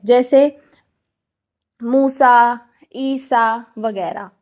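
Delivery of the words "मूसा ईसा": मूसा and ईसा with long, stretched vowels; मूसा, ईसा read slowly, vowels held long; drawn-out long vowels. Shown as a demonstration. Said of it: "उसको थोड़ा लंबा खींच के पढ़ना".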